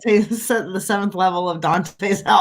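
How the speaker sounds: normal talking voice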